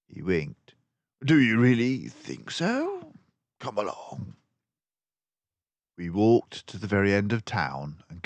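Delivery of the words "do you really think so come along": a wink in the voice; teasing Basil. A narrator's reading. Basil teasing Dawson, playful.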